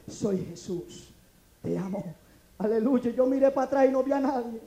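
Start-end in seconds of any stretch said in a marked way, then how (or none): 0.1-2.0 low, slow and hushed; gentle, quiet voice of God; very soft voice. Acted. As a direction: speak it softly and low, unhurried. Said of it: "aquella voz tan suave que me dice".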